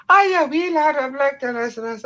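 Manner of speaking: grandma's high, loud mock-Scottish greeting; imitated Scottish accent. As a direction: mess with strangers in high Scottish gibberish. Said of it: "we'd just put on the Scottish accents".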